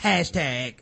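slow, low, soft sneer; mocking tweeters. Online crowd mocking his tears, slow low sneer. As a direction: drag it out like a smug troll.

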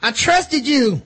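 high, hammed-up betrayed rage; mock furious. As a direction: yell it like a wounded, outraged brute. Betrayed villain yelling at woman.

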